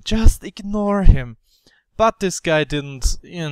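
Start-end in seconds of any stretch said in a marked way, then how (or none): 0.0-1.4 firmer self-talk. Retold or imitated. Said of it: "I just thought".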